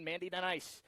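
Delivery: ordinary talk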